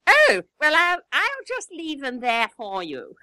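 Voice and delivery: high, slow upper-class drawl; the grand lady graciously giving in; mock posh English lady. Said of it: "she said".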